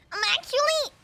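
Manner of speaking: high-pitched bratty whine. Pretend. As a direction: pipe up like a bratty kid. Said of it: "that was the brat voice, kinda".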